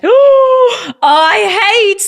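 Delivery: high, slow, drawn-out disgust; gasping disgust. Real, not acted. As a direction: gasp and stretch it out.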